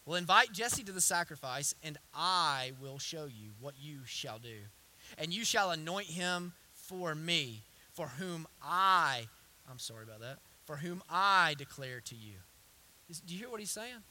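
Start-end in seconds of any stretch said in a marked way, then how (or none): 0.1-9.3 slow, lowered, weighty reading; measured, solemn verse reading; scripture reading. Real, not acted. 10.6-12.4 slow, lowered, weighty reading; measured, solemn verse reading; scripture reading. Real, not acted.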